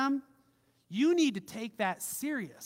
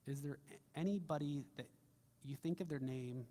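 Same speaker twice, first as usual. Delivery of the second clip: quiet prayer lead; 5 semitones lower, 8 dB quieter than usual. Acted.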